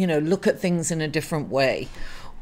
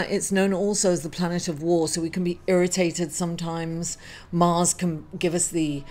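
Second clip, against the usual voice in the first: usual speaking voice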